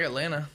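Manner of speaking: Atlanta with the second T dropped, "At-LAN-a"; local pronunciation. Shown as a demonstration. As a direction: swallow the T in Atlanta. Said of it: "You don't say Atlanta".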